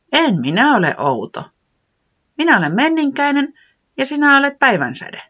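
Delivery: high, quick character voice; high fast weatherman reply; weatherman answering. Acted. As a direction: brightly correct her. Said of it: "säämies vastasi".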